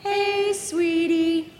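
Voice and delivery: very high, loud whistled "Hey, sweetie"; chickadee song. Shown as a demonstration. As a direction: copy the chickadee call back, up high. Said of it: "Can you guys do that?".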